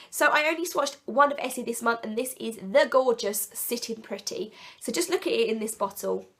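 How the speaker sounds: normal talking voice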